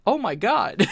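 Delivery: high, loud shout of disbelief; loud startled exclaim; stunned oh my God. Retold or imitated. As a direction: cry out in amazement. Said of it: "I'm like".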